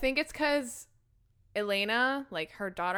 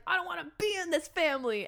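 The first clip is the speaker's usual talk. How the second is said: higher-pitched mock protest; upset child; 6 semitones higher than usual. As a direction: protest like a boy fed up with relatives. Upset boy rejecting his family.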